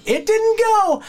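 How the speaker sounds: high, loud pained yelp; callback scream. Retold or imitated. Teller quoting the famous catchphrase to his cohost, high yelp. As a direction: echo the famous howl as a punchline. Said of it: "The Tulip".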